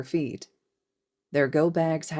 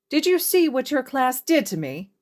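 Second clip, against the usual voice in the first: high, loud outraged demand; loud, high, wounded complaint to his colleagues; indignant demand; 9 semitones higher, 5 dB louder than usual. Acted. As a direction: demand it like the victim of a prank. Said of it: "Hizashi demanded".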